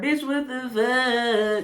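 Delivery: slow, drawn-out mock outrage; a slow, exaggerated "Bitch, what the fuck?"; spirit's exclamation. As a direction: stretch it out like a voice from beyond. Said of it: "I heard".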